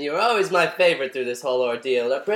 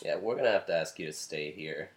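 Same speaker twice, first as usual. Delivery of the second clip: low showman voice; 12 semitones lower, 10 dB quieter, faster than usual. Acted. Hollywood host character, low voice.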